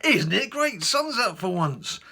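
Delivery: very high, loud, gushing reply; frenzied cheer. Retold or imitated. Answering the courier, in gushing cheer. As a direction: outdo his enthusiasm. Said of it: "I said".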